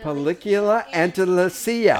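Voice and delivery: "Película Andalucía" in a Spanish accent; Spanish pronunciation. Shown as a demonstration. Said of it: "Can you say película Antendencia".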